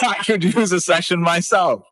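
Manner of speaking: amused impression of a worn-out therapist; mock therapist. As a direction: joke as the therapist who needs help herself. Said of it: "They're like".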